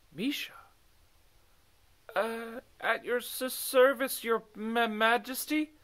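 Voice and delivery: high, halting, slow startled stammer; stuttering nervously. Acted. Misha answers the king, stuttering and surprised. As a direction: trip over the words, caught off guard. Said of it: "stuttered a little as she answered".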